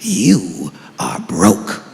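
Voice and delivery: very low, slow, solemn father voice; deep dad lecture. Retold or imitated. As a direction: drop deep and preach it like a wise dad. Father lecturing son, retold.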